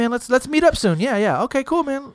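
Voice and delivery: high, casual quoted friend making empty plans; breezy friend. Retold or imitated. Friend casually promising to meet up, breezy and high.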